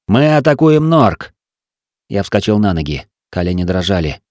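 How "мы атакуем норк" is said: high, drawn-out command; attack order. A narrator's reading. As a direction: announce the attack to the crew.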